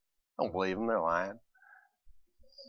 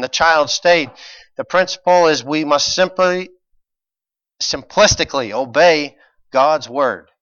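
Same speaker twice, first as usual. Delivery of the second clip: rising, louder preaching build; 4 semitones higher, 7 dB louder than usual. Acted. Pastor preaching to the congregation, building fervor. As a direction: preach it with growing force.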